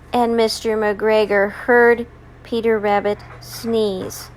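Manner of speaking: ordinary delivery, nothing marked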